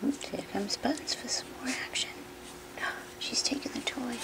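hushed whisper; whispered commentary. Real, not acted. Owner whispering as another puppy joins. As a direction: narrate it under your breath.

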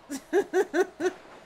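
quick, loud nervous laugh; burst of laughter. Real, not acted. Streamer laughing at chaos mid-fight, loud nervous laugh.

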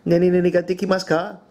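"Nani nani ga dekimasu ka?" quick and high, like an eager student; eager pupil's Japanese; student's Japanese question. Shown as a demonstration. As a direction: rattle off the Japanese line. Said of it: "They should say".

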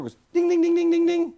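high, sung ding ding like a door bell; dog ringing the door bell; imitated bell ringing. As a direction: chime it out way up high. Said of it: "The dog goes to the back door".

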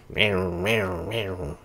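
imitating a revving little scooter engine; playful engine noise impression; mock scooter whine. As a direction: buzz it like a tiny moped.

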